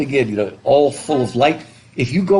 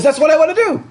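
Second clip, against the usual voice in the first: eager character; 4 dB louder, faster than usual. Retold or imitated. Quoting Rinpoche's wish.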